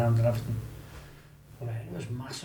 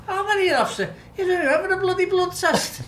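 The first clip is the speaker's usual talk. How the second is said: high, mocking imitation of the boss; boss's mock outburst. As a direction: mimic the boss scolding in a squeaky voice. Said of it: "And the boss went".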